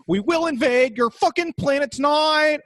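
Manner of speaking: high, menacing alien threat. Pretend. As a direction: alien warning the planet. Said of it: "the aliens were like".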